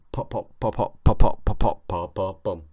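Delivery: rapid popping noises with sudden loud bursts; bubble wrap impression. Shown as a demonstration. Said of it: "that's my impression of a, uh, bubble wrap".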